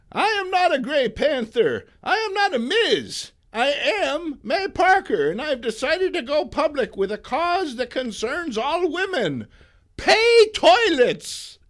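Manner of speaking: put-on high, loud crusading old woman; spirited old lady. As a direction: stress each NOT like a rally speaker. May's speech on talk show.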